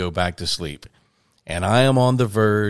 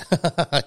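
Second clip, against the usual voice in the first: short high giggle; imitated giggling fit, brief and high; retold giggle; 7 semitones higher than usual. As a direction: giggle as if reliving the moment. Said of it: "So I start giggling".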